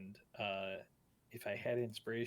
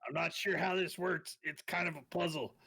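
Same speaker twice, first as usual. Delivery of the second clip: louder, higher in-character voice; in-character fretting; 7 semitones higher, 8 dB louder than usual. Acted. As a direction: puzzle it out loud, pitched up. Player voices character fixing severed hand.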